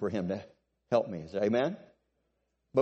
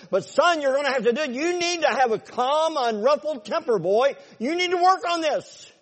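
loud, high coach barking at his son to run; booming father figure pushing his boy on; the Bible as stern coach; 13 semitones higher, 7 dB louder than usual. Acted. The Bible as a coach, loudly urging its son on. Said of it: "And the Bible says".

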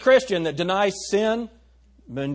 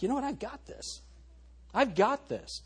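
cocky self-talk; 7 semitones higher, 8 dB quieter than usual. Retold or imitated. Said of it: "'Cause you start to think".

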